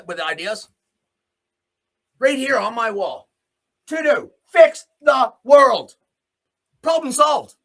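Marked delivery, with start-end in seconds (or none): 2.2-7.5 mock triumphant. Real, not acted. Reveals his sarcastic wall plan.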